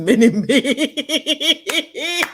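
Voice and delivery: slow, laughing through the words mini me; real laugh, drawn out slowly. Real, not acted. Laughing at own joke.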